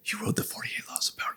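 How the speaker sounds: quick, hushed whisper; retold whisper, very soft and hurried; imitated whisper. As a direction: whisper it like a furtive confidence. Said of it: "she whispered".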